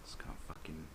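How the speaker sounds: whispered in frustration; defeated whisper; exasperated whisper. Real, not acted. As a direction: breathe it out under your breath. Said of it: "exasperated whisper".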